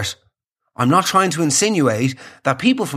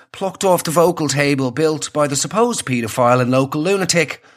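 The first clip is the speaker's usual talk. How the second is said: normal talking voice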